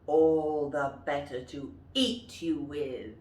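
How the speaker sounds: menacing wolf, full voice. Shown as a demonstration. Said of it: "And the wolf says".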